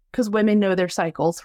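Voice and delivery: normal talking voice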